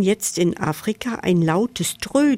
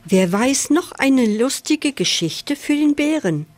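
mild, higher-pitched weasel call; 5 semitones higher than usual. A narrator's reading. Weasel asking the animals for a story, calling out. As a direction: call it out lightly to a group. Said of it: "ruft das Wiesel laut".

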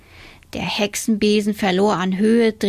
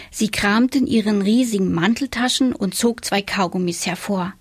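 plain reading voice

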